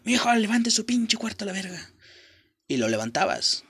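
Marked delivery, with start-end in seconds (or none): 0.0-1.9 high-pitched mock yell of an angry mom. Imitating an angry mother yelling at her son to clean his room, mock yell. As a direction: bark the order like a fed-up mother, half-playful. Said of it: "te gritaba".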